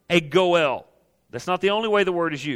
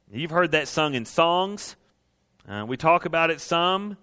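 ordinary talk, nothing marked

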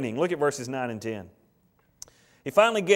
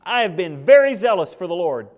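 with tone; 3 semitones higher, 9 dB louder than usual. Acted. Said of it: "that's said with tone".

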